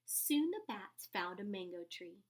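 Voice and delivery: plain reading voice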